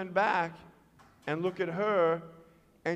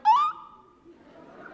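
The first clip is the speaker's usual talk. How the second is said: very high, loud imitated cry; mock pained cry; 5 dB louder than usual. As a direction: squeal as if the foot just bent back. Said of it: "I was like".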